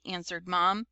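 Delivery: usual speaking voice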